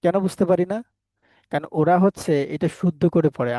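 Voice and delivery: ordinary talk, nothing marked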